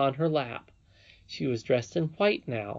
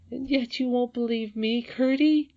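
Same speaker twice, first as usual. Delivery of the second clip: very high, plaintive expostulation; crying with vexation; 11 semitones higher than usual. A narrator's reading. Princess reproaches Curdie for disbelief, tearfully vexed. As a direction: plead tearfully, pitched right up. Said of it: "now fairly crying with vexation and sorrow".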